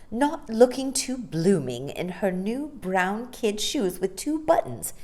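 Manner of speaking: plain reading voice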